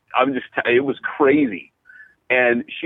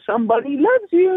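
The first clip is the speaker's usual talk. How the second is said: high sing-out voice, much higher than usual; imitating manic churchgoer; 13 semitones higher than usual. Churchgoer bringing bunnies in to people, high imitated gushing. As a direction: put on a high, cutesy voice, imitating her. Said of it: "she'd go".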